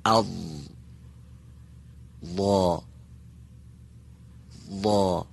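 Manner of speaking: «ضا» with the tongue side on the upper molars, thick and backed; emphatic dad. Shown as a demonstration. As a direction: say «اض، ضاد، ضا» slowly, heavy d-like sound. (ضاد درشت با صدای شبیه دال)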